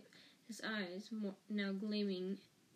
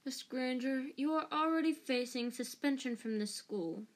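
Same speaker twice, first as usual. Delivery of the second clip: louder, higher, angry reprimand; spitting scolding; 4 semitones higher, 6 dB louder than usual. A narrator's reading. Snape scolds Hermione about suspension, spitting the words. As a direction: tell off a pupil with forceful indignation. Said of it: "Snape spat".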